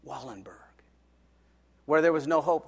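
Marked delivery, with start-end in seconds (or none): 0.0-0.8 broken, hushed sobbing of the name; a mother's tearful whisper of thanks. Retold or imitated. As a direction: whisper it through tears, fading away. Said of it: "she starts sobbing in his ear".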